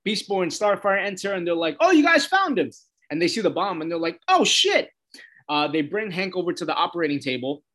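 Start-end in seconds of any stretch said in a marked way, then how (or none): 1.8-2.7 high, louder chirpy greeting; cheery surprise. Retold or imitated. Beast Boy and Starfire greeting the team, high and cheery. 4.3-4.9 high, loud startled gasp of a curse; shocked. Retold or imitated. Heroes spotting the bomb in Hank's chest, high and startled.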